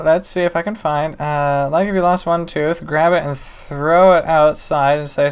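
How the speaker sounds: ordinary talk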